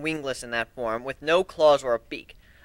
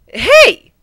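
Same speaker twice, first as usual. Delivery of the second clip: much higher, louder pony cry; indignant protest; 13 dB louder than usual. Acted. Twilight protests as Griffin grabs the letters, much higher and louder. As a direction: object sharply.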